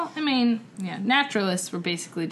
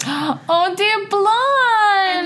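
delighted gasp; 12 semitones higher, 8 dB louder, much slower than usual. Real, not acted. Gasps at blonde penguin photo.

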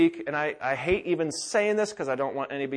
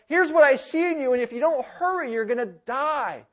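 higher, louder, urgent warning as the Lord; urgent divine warning, pitched up; the Lord's urgent voice; 9 semitones higher, 5 dB louder than usual. Acted. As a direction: plead with alarm, as God calling a sinner. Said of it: "What I hear in the Lord's voice".

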